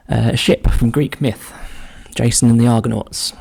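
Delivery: ordinary talk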